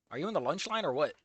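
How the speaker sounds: usual speaking voice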